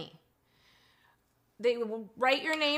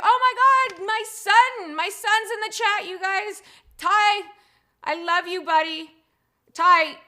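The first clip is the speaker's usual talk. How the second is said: high, loud and delighted; excited shout-out to her son, loud and high; thrilled mom; 8 semitones higher, 8 dB louder than usual. Real, not acted. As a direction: gush over her son who just joined the chat. Her son joins the chat.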